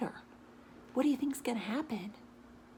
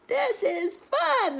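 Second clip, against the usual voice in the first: very high, loud, slow laughing cry; laughing cheer; 8 dB louder, much slower than usual. Acted. Animals cheer on bikes.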